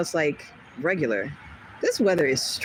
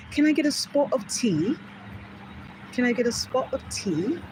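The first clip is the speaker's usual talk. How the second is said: higher, lilting mock English accent; 5 semitones higher, 3 dB quieter than usual. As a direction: imitate an English tea-drinker's accent. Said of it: "English people. They're like".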